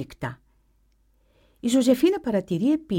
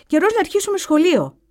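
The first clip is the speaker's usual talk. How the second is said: voiced boy; 10 semitones higher, 7 dB louder than usual. A narrator's reading. Boy answers the teacher.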